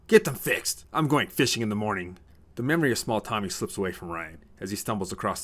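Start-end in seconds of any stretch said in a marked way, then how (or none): 0.1-2.2 loud order, easing after the first burst; yelling back. A narrator's reading. Father heads to bedroom, yelling back at his sons. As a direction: yell an order over your shoulder. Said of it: "He yells back".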